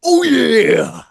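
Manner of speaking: loud, high gravel-free wrestler shout; hammed-up Macho Man shout; wrestler catchphrase. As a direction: bellow it like a pro wrestler.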